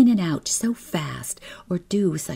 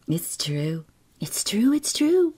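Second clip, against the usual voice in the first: breathy, climbing higher with each repeat; insistent gossip; 5 semitones higher than usual. A narrator's reading. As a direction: start hushed, build to a high excited confirmation. Emmy confirms gossip, rising insistence.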